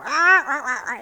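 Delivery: high, loud parrot squawking; parrot squawks. Retold or imitated. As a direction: squawk out like a bird. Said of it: "the parrot would go".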